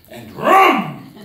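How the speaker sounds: playful engine noise; engine vroom. Pretend. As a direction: make the Ferrari roar. Car speeding off.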